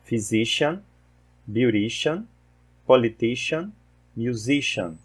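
"physician", "beautician", "musician" ending in a soft "shun"; teacher modelling the -cian ending in "politician"; English -cian endings. Shown as a demonstration. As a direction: model each word, stressing the syllable before "-cian". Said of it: "Mais uma vez".

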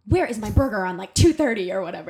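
loud, sharp demand for the missing burger; retold loud demand from a frustrated server; angry demand. Demanding her burger from the sous chef.

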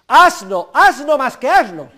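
loud, exasperated "asno", punched each time; hammered-out teacher's rebuke; scolding insult. Acted. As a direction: berate the ignorant student at full voice. Scolds the student of his anecdote.

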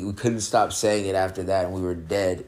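ordinary delivery

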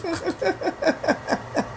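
high, quiet run of laughter; giggling fit. Real, not acted. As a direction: giggle at your own joke. Laughs at own segue.

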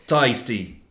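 "Tasty" with an Aussie "tie" sound; light Australian take on "Tasty"; Australian accent. Shown as a demonstration. Co-host repeats his Australian joke word, lightly Australian. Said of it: "If you say Thai iced tea like an Australian".

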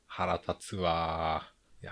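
slow, soft, drawn-out grumble. Real, not acted. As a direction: let the annoyance drag out.

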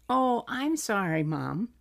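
put-on toddler apology. Mom imitating her son apologizing, lightly childlike. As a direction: apologize like a sweet two-year-old. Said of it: "you say".

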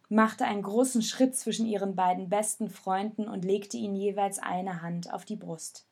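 ordinary delivery

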